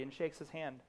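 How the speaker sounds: normal talking voice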